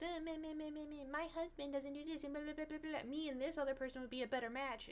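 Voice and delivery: high, soft whiny mockery; mock the drama with nasal bleating; whiny mock complaining. Mocking dramatic reality-show wives complaining about husbands, in a high whiny voice. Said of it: "super dramatic and just".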